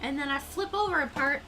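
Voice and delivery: real giddy excitement; excited. Real, not acted. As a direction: burst out with delight over a footrest. Thrilled about chair footrest.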